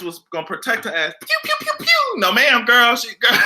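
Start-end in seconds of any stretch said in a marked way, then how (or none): 1.2-2.2 high squeaky blaster noises; mock laser sounds. Imitating Wonder Woman bracelets deflecting attacks with high pews. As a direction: zap like deflected shots.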